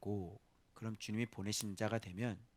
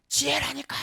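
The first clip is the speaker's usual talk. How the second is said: high, loud insistent shout; calling out; 12 semitones higher, 7 dB louder than usual. Acted. Wisdom shouting after people who walk past. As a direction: cry out urgently. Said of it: "소리 지르고 막 부르죠".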